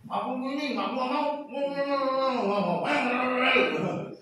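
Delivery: put-on high, babyish whining of "mau, mau"; lightly voiced child throwing a tantrum; whiny child. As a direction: sound like a sulking little kid. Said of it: "Gayanya anak kecil".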